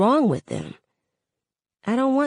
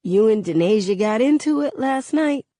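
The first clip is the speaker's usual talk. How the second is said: ordinary delivery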